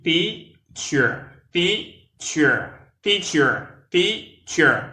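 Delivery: Teacher said high and loud, TEA stressed, clear ch; two syllables TEA-cher; model pronunciation. Shown as a demonstration. Teacher modelling Teacher for learners. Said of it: "Try, essayez".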